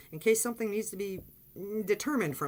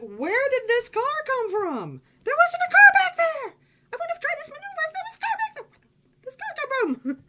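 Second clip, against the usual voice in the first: shrieking, much higher and louder in disbelief; panicked yelling; 9 dB louder than usual. Retold or imitated. Driver shrieking in panic after being hit while backing. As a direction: freak out at the car from nowhere. Retelling panic at sudden crash.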